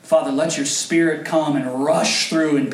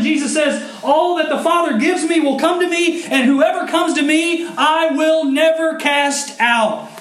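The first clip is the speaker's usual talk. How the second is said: much higher, louder fervent preaching; preaching at full force; 13 semitones higher, 7 dB louder than usual. Acted. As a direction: preach it out, urging the congregation. Preaching the sermon's close.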